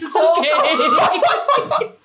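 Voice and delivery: slow laughing reassurance. Real, not acted. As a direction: laugh it out slowly. Laughing drawn out.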